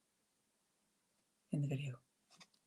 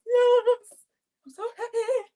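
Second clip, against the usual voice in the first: much higher, louder burst of delight; excited squeal; 5 dB louder, slower than usual. Retold or imitated. Host retelling her reaction to homework, high thrilled squeal. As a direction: squeal with joy at seeing homework. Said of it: "I'm like".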